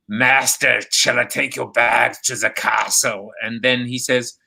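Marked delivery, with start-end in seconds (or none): 0.1-3.3 lightly voiced servant, slow; a hint of a hunched servant asking slowly; Igor quote. Host quoting Marty Feldman's Igor, lightly voiced slow servant. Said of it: "when he goes".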